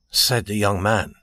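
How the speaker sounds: usual speaking voice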